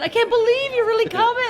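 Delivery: high, loud excited squeal; excited disbelief. Retold or imitated. Quoting Susan's disbelief at the visit, excited.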